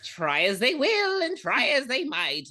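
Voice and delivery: high-pitched, singsong leprechaun verse; leprechaun rhyme. Acted. Co-host recites the leprechaun's rhyme, high leprechaun voice. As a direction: recite it high like a menacing imp.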